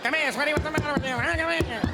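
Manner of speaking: put-on high, fast-talking old-film leading man; 1930s movie guy. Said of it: "old nineteen thirty guy's voice".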